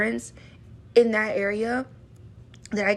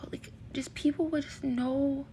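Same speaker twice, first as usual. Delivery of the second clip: sighing whisper; 4 semitones higher, 6 dB quieter than usual. Real, not acted. Exasperated sigh, then hushed.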